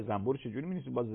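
ordinary delivery

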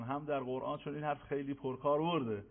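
usual speaking voice

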